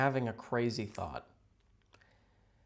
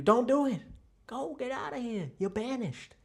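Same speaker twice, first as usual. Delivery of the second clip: mock dramatic banishing; 12 semitones higher, 3 dB louder than usual.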